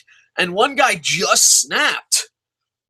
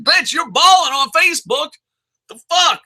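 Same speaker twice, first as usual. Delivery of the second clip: high, indignant comeback; raised-pitch disbelieving scolding; acted incredulous retort; 9 semitones higher, 4 dB louder than usual. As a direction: call out the show-off in mock outrage. Acted retort to broke borrower.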